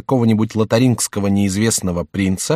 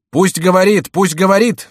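high, louder urging cries; crowd outcry; 9 semitones higher, 5 dB louder than usual. A narrator's reading. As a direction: call out eagerly, like a whole assembly. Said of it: "вскричали остальные". (громкие выкрики толпы)

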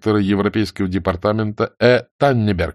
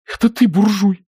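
high-pitched, upset comeback; sobbing retort; 11 semitones higher, 4 dB louder than usual. A narrator's reading. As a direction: throw it back, on the verge of tears. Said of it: "всхлипнула Нина". (обиженно, почти всхлипывая)

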